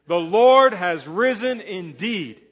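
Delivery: a loud, slow declaration of faith; with gusto. Shown as a demonstration. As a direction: proclaim it with gumption. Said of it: "say it like we mean it".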